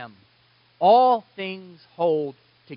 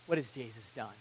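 ordinary talk, nothing marked

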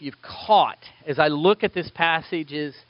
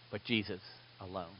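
much softer, fading almost to silence; dropping to nothing; 9 semitones lower, 19 dB quieter than usual. Acted. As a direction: let the last words vanish into a hush. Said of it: "we just went from really loud to nothing".

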